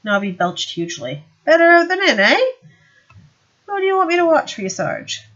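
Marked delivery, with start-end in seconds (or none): 1.4-5.2 high, fast wheedling little watchman; Nobby's voice. Acted. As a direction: pitch it up as the shifty Nobby. Nobby speaks to Vimes.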